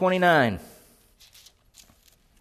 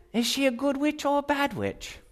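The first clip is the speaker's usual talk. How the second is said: higher, softer munchkin squeak; teasing munchkin voice; 5 semitones higher, 10 dB quieter than usual. Retold or imitated. Family imitated as munchkins, higher and softer. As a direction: play the tiny relative asking timidly. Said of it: "And they were like".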